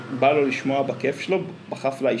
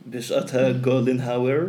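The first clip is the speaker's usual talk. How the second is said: Israeli accent. Shown as a demonstration.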